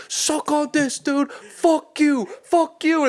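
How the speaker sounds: mock him with crude triumph; jeering yell. Taunting driver, grabbing crotch.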